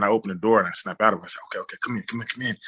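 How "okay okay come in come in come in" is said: softer, quick reassurance to come in; softly urging the wounded girls inside; hushed urging. Retold or imitated. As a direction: coax them inside under your breath. Pulling wounded girls through the door.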